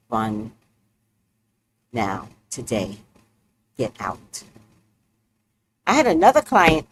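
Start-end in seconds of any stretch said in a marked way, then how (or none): 0.1-4.4 much lower, softer command; low deadpan order. Retold or imitated. Reader to a client who must leave, low deadpan order. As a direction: quietly order them out, word by word. Acts telling client to run.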